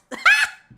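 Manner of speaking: very high, loud burst of laughter. Real, not acted. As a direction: shriek with laughter. Laughs at own joke.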